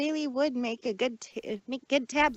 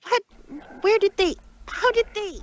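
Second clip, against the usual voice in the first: high, loud, sputtering fury; outraged; 8 semitones higher, 7 dB louder than usual. Acted. Trixie reading the exposé, outraged and shouting. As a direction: blurt it out in shocked outrage.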